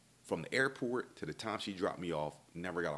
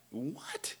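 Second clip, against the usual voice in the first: shocked what; 6 semitones higher, 4 dB quieter than usual. Retold or imitated. Hearing about the guy.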